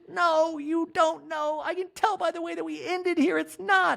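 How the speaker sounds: very high whiny voice; Kyle voice. Retold or imitated. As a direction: whine it up high.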